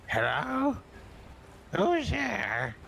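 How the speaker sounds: high voice from behind the door; talking lion knocker. Acted. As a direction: play the lion face on the knocker.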